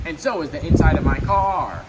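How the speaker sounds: loud, angry complaint; yelled. A narrator's reading. Donald yelling about his soaked car, loud. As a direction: keep yelling about the wet seats. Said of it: "he yelled".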